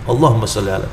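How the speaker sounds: soft, fast, half-whispered murmur of the prayer; a private salawat that needs no loudness; quiet rushed salawat. Shown as a demonstration. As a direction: mumble it under your breath, quickly. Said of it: "Ya pokoknya bersalawat".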